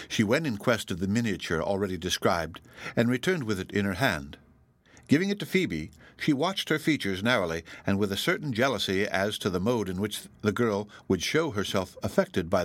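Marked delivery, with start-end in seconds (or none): none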